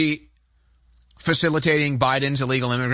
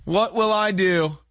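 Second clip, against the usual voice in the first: mock fear; 10 semitones higher than usual. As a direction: wail in fake terror. Mock panic over DMV.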